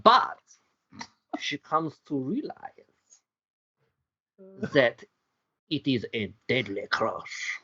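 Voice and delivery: hammed-up French accent with pauses. Host building to the twist, slipping into French accent. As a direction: attempt German, come out French. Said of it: "Going French again".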